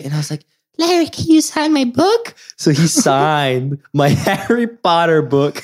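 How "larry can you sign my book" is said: put-on very high, small child voice; pleading little kid. Ten-year-old asking Larry Bird to sign, high squeak. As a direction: mimic a child timidly asking a hero.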